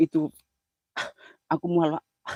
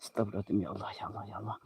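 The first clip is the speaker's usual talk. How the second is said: whispered prayer; 8 semitones lower, 14 dB quieter, faster than usual. Real, not acted. Whispered prayer while sick.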